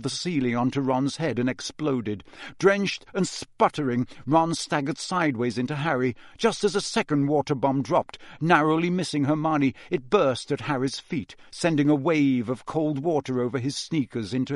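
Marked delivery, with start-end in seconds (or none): none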